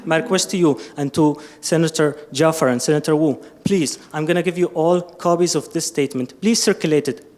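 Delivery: ordinary talk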